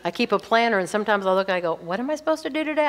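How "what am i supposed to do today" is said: puzzled self-question. Retold or imitated. Puzzling over own planner.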